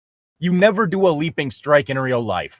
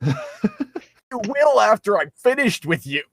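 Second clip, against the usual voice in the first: slow, much higher line breaking into laughter; mock seductive villain, laughing; 8 semitones higher, slower than usual. Mocking Darklink's threat to Link, slow and laughing. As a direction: overplay the innuendo and crack up.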